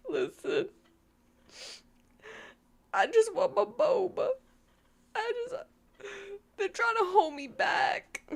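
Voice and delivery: high, whiny put-on New York accent. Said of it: "I was doing the New York accent earlier".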